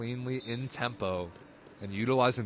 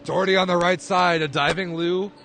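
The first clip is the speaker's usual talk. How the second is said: higher, louder rally call, rising with the play; play-by-play burst of excitement; excited call; 7 semitones higher, 10 dB louder than usual. Real, not acted. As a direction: call the dig as it happens. Live rally call, big play.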